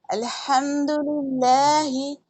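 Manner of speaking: slow chanted tajweed recitation of अल्हम्दुलिल्लाह; Quran recitation. Shown as a demonstration. Teacher reciting Fatiha for her students, slow and melodic.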